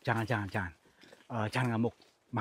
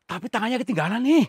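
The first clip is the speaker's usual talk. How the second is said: loud, high shocked exclamation; 11 semitones higher, 12 dB louder than usual. Real, not acted. Ghost hunter shouting to his crew about a left-behind hand, shocked. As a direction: blurt it out in disbelief.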